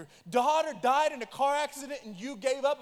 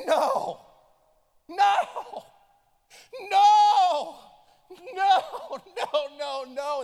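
high, loud anguished cries of no; retold survivor breaking down crying; sobbing no; 10 semitones higher, 11 dB louder, much slower than usual. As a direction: wail no as if breaking down. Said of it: "he just started crying".

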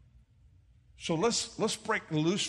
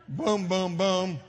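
playful boom sounds; 2 semitones higher, 5 dB louder, much slower than usual. Retold or imitated. Acting out the hug.

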